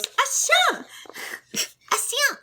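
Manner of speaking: high, pleading cry of a name; pleading sister. Acted. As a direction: call him back, high and pleading. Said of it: "she goes".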